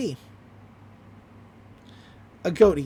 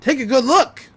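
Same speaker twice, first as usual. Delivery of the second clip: loud gloating reveal; triumphant; 2 semitones higher, 6 dB louder than usual. Retold or imitated. Stan exposing Gideon to the crowd, crowing loudly. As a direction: show them up, crowing.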